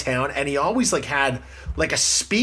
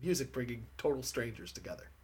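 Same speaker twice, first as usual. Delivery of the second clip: muffled, underwater microphone sound; 3 semitones lower, 7 dB quieter than usual. Real, not acted. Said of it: "It sounds like you're underwater now".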